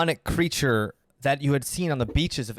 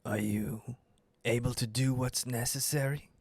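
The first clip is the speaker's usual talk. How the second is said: menacing demon; 2 semitones lower, 6 dB quieter than usual. Acted. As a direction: test them softly and darkly. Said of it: "And he stares at you".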